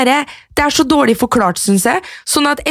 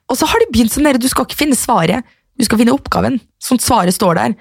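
ordinary delivery

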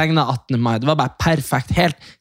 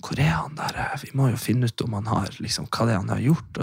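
much softer, low, confidential question; quiet aside; 3 semitones lower, 7 dB quieter than usual. Retold or imitated. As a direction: lean in and ask discreetly. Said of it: "lente jeg meg over til noen andre". (dempet spørsmål)

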